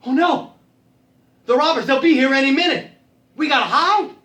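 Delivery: high, loud warning; alarmed. Acted. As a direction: raise the alarm in a panic. Robbers due back, they must hide.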